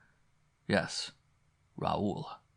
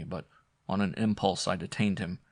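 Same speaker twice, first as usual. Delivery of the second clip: usual speaking voice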